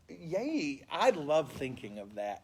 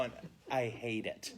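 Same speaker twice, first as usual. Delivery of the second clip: ordinary talk, nothing marked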